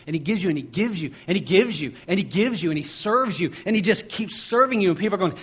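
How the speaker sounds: fast then loud and high, full-force preaching; building sermon climax. Acted. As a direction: build it like a preacher hitting the peak.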